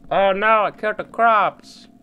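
high, loud cry of dismay. Real, not acted. Gamer crying out as the crops die. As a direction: wail about the crops.

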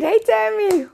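high happy hello; excited greeting. Real, not acted. Greeting viewer Tammy on the live stream, excited.

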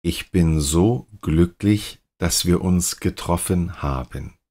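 ordinary talk

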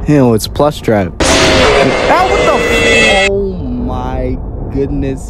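usual speaking voice

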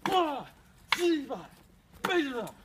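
high, loud hammed-up duel shouts; playful battle cries. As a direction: throw each cry like a spell.

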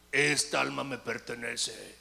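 slow, drawn-out demon voice; imitated demonic voice, slowly; possessed woman's voice. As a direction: drag out the words as a demon claiming a soul. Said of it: "la voz de ella había cambiado".

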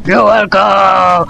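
low, slow mock-menacing order; stern commander. Statue ordering its scared companion, stern. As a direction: draw it out like a villain's command.

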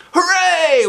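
loud high cheer; hooray yell. Acted. Kids cheer the chocolate deal, loud. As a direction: cheer at the top of your voice. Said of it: "we all yelled".

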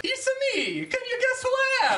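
high-pitched cartoon plumber voice; Mario. Player asking friends to guess the character, high cartoon voice.

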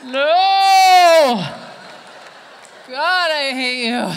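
high, very loud drawn-out outburst; anguished shout. Acted. Audience member can't handle another second, anguished shouting.

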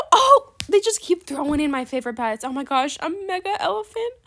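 gushing, climbing to a high squeal; overwhelmed delight. Pretend. Overjoyed at pets in trade offer.

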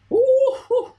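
high loud whoop; excited whoop. Real, not acted. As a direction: whoop with joy. Halftime lead.

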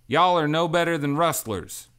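high, loud furious outburst; angry accusation. Acted. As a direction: spit the accusation at them. Lark accuses angrily.

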